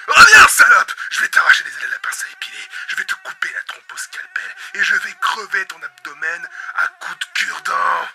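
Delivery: loud enraged yelling; furious threats. Acted. Host threatening the returning insect, furious. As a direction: threaten it with gleeful fury.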